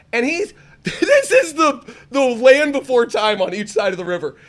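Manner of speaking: high, loud, laughing through the words; can barely get it out for laughing; laughing hard. Real, not acted. Telling friends about the chained monkey, laughing hard and high.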